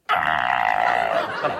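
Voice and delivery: low guttural camel grunt; camel imitation. Monitor mimics a camel for children, low grunt. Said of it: "Je vous fais le chameau".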